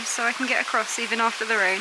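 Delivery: normal talking voice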